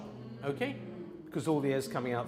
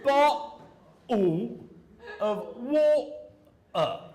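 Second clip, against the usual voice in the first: East London accent, "Bottle" and "water" with glottal stops, slow, louder; Cockney glottal stops; 6 semitones higher, 7 dB louder, much slower than usual. Shown as a demonstration. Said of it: "they're making glottal stops".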